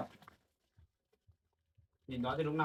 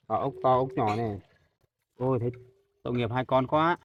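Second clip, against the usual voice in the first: louder, exclaiming over the little snails; loud pitying exclaim; 2 semitones lower, 10 dB louder than usual. Real, not acted. Pitying kids found picking snails.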